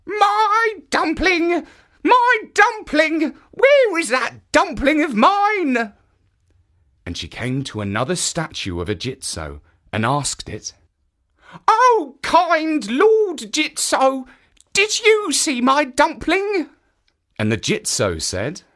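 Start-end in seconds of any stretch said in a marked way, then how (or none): 0.1-5.9 put-on high, slow old woman calling; old woman crying out. 11.7-16.7 put-on old woman voice. Old woman asks statue.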